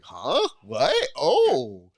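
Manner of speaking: mock surprise in short bursts; retold bafflement. TV worker seeing stars off camera, baffled double-take. Retold shock at behind-scenes sights.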